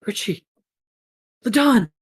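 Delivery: high calls, rising to a shout; alarmed calling. Acted. Gecko searching for wounded friend, alarmed calling.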